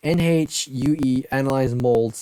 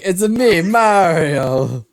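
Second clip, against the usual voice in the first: loud, higher mock Italian accent; Mario; 5 semitones higher, 4 dB louder, much slower than usual. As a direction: shout out a cartoon Italian catchphrase. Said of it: "Luigi sounds like a pretty Italian name".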